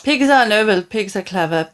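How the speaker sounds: ordinary delivery, nothing marked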